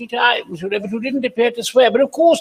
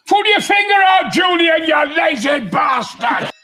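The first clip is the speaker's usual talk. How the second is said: booming shout; 7 semitones higher, 3 dB louder than usual. Acted. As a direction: roar it, higher and louder. Bellowing at Julian.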